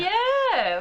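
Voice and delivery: cheering her home town; exultant yeah. Real, not acted. Exultant answer.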